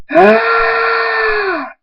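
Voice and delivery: long, very high sustained scream; shrieked, drawn-out "ah". Acted. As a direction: cry out as if struck. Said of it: "Thunderstorm screams".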